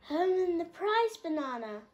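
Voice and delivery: high, loud call; urgent call. A narrator's reading. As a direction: call for the banana.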